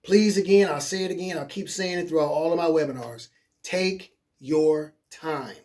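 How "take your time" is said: drawn out, word by word; slow emphasis. Real, not acted. Coach repeating key advice to webinar viewers, slow and deliberate. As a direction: spell it out very slowly, letting each word land.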